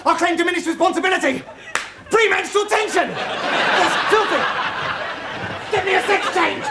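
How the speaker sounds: high-pitched, protesting outburst; panicked shouting. Acted. Richie refusing to face prison, panicked shouting. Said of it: "Please stop shouting".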